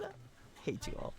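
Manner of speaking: high breathless whisper; whispered despair. Real, not acted. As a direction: whimper it in mock defeat. Defeated complaint to chat.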